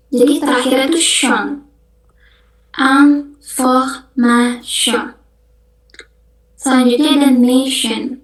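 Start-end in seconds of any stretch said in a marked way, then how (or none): 2.7-5.1 "Information" very slow, aŋfohmaʃo; drawn-out French "Information"; French pronunciation. Shown as a demonstration. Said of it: "kita bacanya information".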